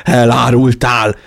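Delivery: loud, slow, bitter accusation; angry accusation. A narrator's reading. Narrator accuses Tony while fighting, bitterly loud. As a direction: hurl it out with hatred. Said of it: "haragom és gyűlöletem".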